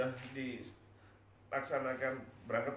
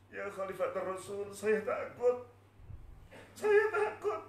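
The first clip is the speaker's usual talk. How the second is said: high cry swelling louder; tearful fear; 7 semitones higher, 4 dB louder, slower than usual. Acted. Bilal answering Abu Bakar, weeping with fear. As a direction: build into an acted, terrified sob. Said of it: "mengapa kamu menangis sampai seperti ini".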